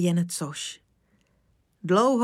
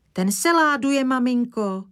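put-on high, louder child tattling; child reporting; 6 semitones higher, 4 dB louder than usual. Little Zdeňa tattling to her mother in the kitchen, high gleeful voice. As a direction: tell on him with glee. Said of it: "hlásila Zdeňa".

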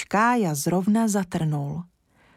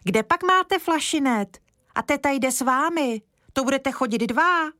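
put-on eager child; 7 semitones higher than usual. A narrator's reading. Kája calls out to the uncle.

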